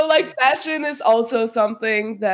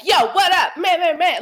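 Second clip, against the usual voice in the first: higher, hammed-up street greeting; cringy slang imitation; 7 semitones higher than usual. Mocking how they would never speak to anybody, cringy slang imitation. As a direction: put on a forced, over-cool slang voice. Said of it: "that was cringy".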